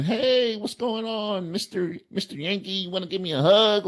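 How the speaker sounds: put-on high, sweet kid voice far up in pitch; young fan voice. Young fan greeting a Yankee fan, high sweet kid voice. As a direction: chirp it like an eager little fan. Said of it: "Versus a younger fan".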